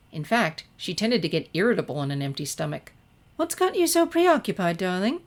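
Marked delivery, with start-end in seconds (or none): 3.4-5.2 high, louder, elegant lady's voice; Rarity. A narrator's reading. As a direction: sing out darling like a fine lady. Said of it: "asked Rarity from across the table".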